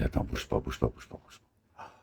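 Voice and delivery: hushed, urgent whisper, barely voiced; tense whisper. Whispering to a guy to stay put, hushed and tense. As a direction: freeze him with a whisper. Telling someone to freeze quietly.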